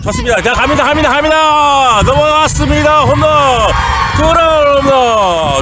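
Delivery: rising home run shout; home run call. Real, not acted.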